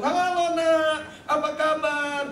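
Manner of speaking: put-on high, slow, drawn-out hello to a child; elated parent's sing-out greeting; happy greeting. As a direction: greet your child with delight, stretching the words. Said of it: "Saya juga seneng".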